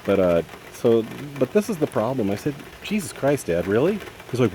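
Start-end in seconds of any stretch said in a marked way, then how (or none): 2.8-4.0 exasperated retort, pitched up at the start. Retold or imitated. Son retelling his retort to his father, exasperated. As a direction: retell it as fed up with Dad.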